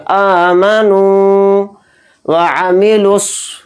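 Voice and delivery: आमनू with the नू drawn out; आमनू chanted slowly; long nu. Shown as a demonstration. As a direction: stretch the oo of आमनू. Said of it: "नू को थोड़ा खींच कर".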